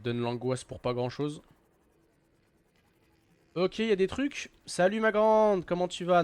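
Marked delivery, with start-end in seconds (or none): 4.6-6.2 high, slow, sugary hello to a monster; playful fake-friendly greeting; mock sweet greeting. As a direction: coo at the creature like a pet. Greets a creature in game.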